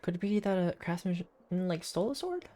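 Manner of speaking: ordinary talk, nothing marked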